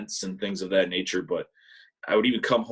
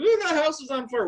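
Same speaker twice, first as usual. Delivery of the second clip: far higher, louder terrified cry; panicked scream; 4 dB louder than usual. Retold or imitated. Mom waking everyone for a fire, panicked scream. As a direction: yell it in a panic. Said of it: "screaming bloody murder".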